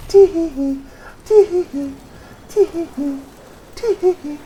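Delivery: put-on high loud girly giggle; hammed-up dainty tee-hees; mock giggle. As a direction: titter like a cartoon schoolgirl. Silly giggle after rage.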